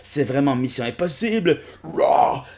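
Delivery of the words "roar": big monster roar. Acted. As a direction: roar like a dinosaur. Rugissement de dinosaure. (rugissement de monstre)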